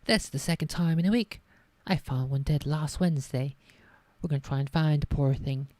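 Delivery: Hagrid attempt slipping into posh English; botched Hagrid accent. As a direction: tries rural Hagrid but lands on posh. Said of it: "I just fucked up the accent so hard".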